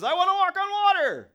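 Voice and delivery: put-on squealed high and loud like a toddler; childish demand. Preacher mimics Peter as a toddler, squealing excitedly. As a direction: beg like a two-year-old at full pitch. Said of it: "like a two-year-old".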